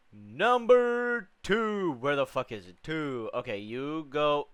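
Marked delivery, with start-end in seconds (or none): 0.1-1.9 slow, high, stretched-out count; mock announcer; slow high drawn call.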